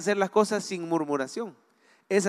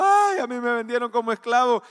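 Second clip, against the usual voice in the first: mock lament; 3 semitones higher than usual. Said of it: "no se anduvo lamentando, ni anduvo llorando".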